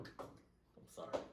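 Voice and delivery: breathy whispered apology; whispering. Real, not acted. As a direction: whisper it sheepishly. Apologizing after outburst.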